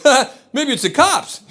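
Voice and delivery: high, slow teasing suggestion; joking. Retold or imitated. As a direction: toss it out as a mock warning. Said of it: "as a joke, goes".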